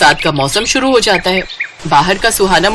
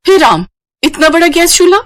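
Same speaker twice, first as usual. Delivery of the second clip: amazed; 8 semitones higher than usual. Acted. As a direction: marvel at the huge stove. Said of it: "हैरान रह जाती है".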